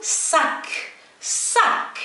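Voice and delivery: high-pitched teacher's model of "صاك" with a clear S; S sound demo. Shown as a demonstration. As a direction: say "صاك" with a plain hissed S at the start. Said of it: "يعني ننطقه مباشرة س".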